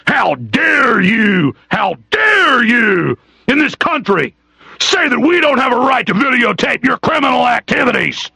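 slow, high-pitched indignant shout; outraged. Real, not acted. Host berating police over the right to videotape, outraged shout. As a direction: draw out each accusation in high outrage.

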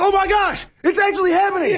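very high, hammed-up amazement; mock shock. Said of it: "hear the shock in his voice?".

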